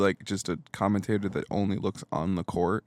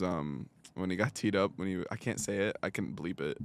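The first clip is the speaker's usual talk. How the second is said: usual speaking voice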